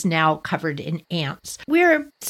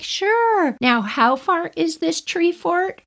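lightly voiced parent, higher pitch; parents' voice; 9 semitones higher, slower than usual. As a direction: indulgent parent questioning the kids, pitched up. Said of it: "our parents are like".

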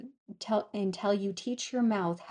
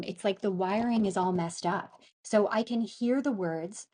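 ordinary talk

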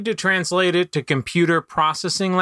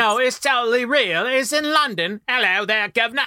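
put-on British accent; 8 semitones higher than usual. Insists chimney sweeps are real.

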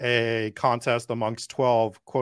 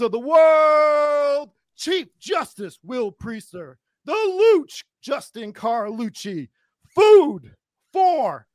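booming high ringmaster call; 8 semitones higher, 5 dB louder than usual. Acted. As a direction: announce it like a circus ringmaster. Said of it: "I wanted to intro you guys".

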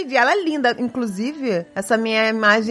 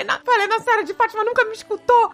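upset complaint; 10 semitones higher, faster than usual. Retold or imitated. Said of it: "Aí eu fiquei tão chateada".

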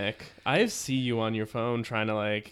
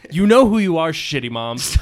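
calling out; 4 semitones higher, 11 dB louder than usual. Real, not acted. Calling out bad moms.

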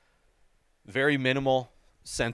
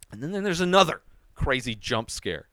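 ordinary delivery

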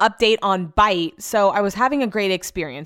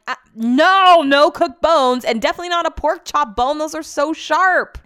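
high, loud, alarmed refusal; mock-alarmed wife refusing the pork chop bone, much higher and louder; emphatic no; 8 semitones higher, 6 dB louder than usual. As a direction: shut the idea down hard, voice way up. Retold refusing husband's request.

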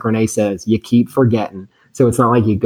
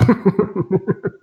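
laughing through the words, much higher; 13 semitones higher, slower than usual. Real, not acted. Imagining the bank lady telling her family, laughing. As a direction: crack up while you tell it.

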